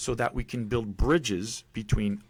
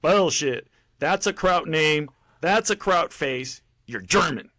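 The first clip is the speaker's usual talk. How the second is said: loud, raised, slow-hammered accusation; retold drill sergeant; 6 semitones higher, 5 dB louder, slower than usual. Drill sergeant disputes a recruit's origins, loud imitated bawling. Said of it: "my drill sergeant".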